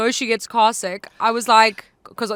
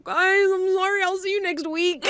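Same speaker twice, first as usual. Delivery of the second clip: sheepish high apology; 8 semitones higher than usual. Retold or imitated. Saying sorry to the staff who knew her, sheepish high voice. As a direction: squeak out a sorry to the whole station.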